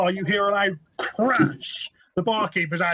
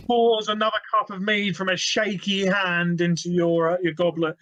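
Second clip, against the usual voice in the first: ordinary talk, nothing marked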